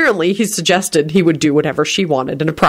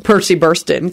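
ordinary delivery

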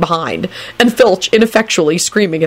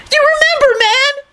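very high squealing cheer; jubilant scream. A narrator's reading. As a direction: scream with delight in a high character voice. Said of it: "Kenny screamed jubilantly".

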